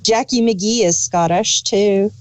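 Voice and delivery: normal talking voice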